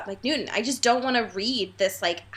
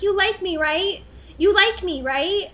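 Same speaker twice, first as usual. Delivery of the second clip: put-on pushy boy voice; 8 semitones higher than usual. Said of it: "Jacob is just like".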